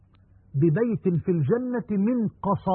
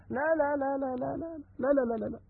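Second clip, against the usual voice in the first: higher, much softer string of no's; soft repeated no; 6 semitones higher, 8 dB quieter, much faster than usual. Real, not acted. Preacher refusing the listener's idea of full bliss, softly. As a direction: shake off the idea gently, again and again.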